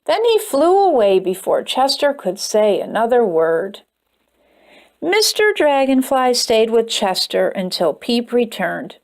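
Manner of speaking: plain reading voice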